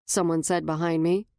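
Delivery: ordinary delivery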